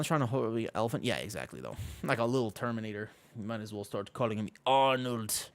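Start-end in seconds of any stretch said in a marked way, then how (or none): 3.4-5.5 clumsy attempt at an Austrian Arnold accent; lightly voiced Austrian Arnold accent, given up fast; half-hearted Austrian Arnold impression; bad Arnold accent. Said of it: "I can't do that accent".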